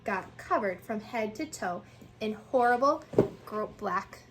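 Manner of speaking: plain reading voice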